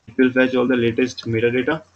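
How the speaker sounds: usual speaking voice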